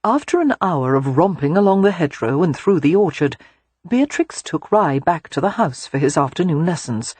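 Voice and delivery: ordinary delivery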